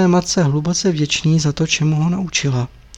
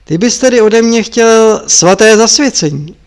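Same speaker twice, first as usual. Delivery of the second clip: louder and higher, a mock-innocent threat; higher, louder, taunting master; menacing question; 6 semitones higher, 6 dB louder than usual. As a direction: ask it as a trap about to spring. Marpa mockingly questions the monk.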